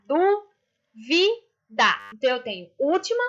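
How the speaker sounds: "duvida" broken into DU, VI, DA with pauses; separated syllables. Shown as a demonstration. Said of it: "se eu separar".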